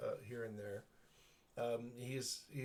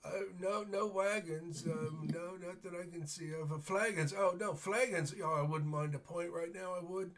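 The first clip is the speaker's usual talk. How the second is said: put-on rambling old man, pitched up; befuddled old man; 6 semitones higher than usual. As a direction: play a muddled old beggar mishearing a question. GM plays confused old beggar.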